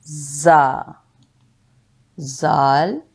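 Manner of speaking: ordinary talk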